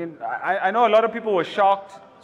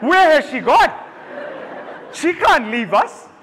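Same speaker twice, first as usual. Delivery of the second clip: loud, higher panicked cry; 8 semitones higher, 4 dB louder than usual. Retold or imitated. Husband panics at the news about Queen, loud and high. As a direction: sell the panic, louder and higher. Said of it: "I was like".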